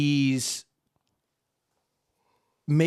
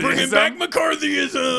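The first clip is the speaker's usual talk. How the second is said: higher, slow, shouted slogan; mock villain shout; 12 semitones higher, 5 dB louder, slower than usual. Pretend villain rallying a crowd, hammed-up shout. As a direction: bellow it like a hammy rally cry.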